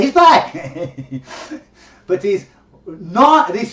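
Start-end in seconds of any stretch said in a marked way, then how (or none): 0.0-0.5 raised voice, calling out openly; mild mock scream. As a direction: call it across a bar, half-heartedly.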